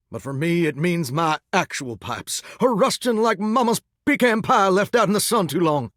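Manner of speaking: ordinary talk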